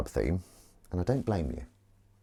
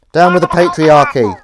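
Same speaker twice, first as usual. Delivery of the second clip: loud, higher rally shout; loud slogan; 6 semitones higher, 14 dB louder than usual. Acted. Mic too close, a loud protest shout. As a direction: yell it like a protest chant.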